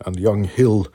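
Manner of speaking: ordinary talk, nothing marked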